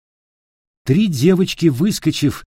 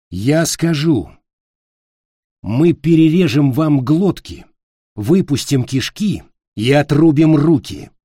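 menacing threat; 4 semitones higher, 5 dB louder than usual. Acted. Peter threatens the witch.

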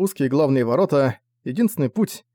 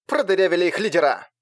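женский голос сквозь треск помех; властный женский голос, как по рации; female voice through static; 5 semitones higher than usual. A narrator's reading. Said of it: "Вклинился сквозь треск новый голос". (властный женский голос сквозь треск)

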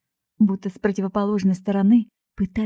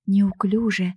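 plain reading voice

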